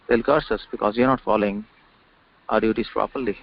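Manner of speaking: normal talking voice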